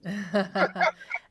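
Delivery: real, amused laugh. Real, not acted. Table laughs at old lady nod.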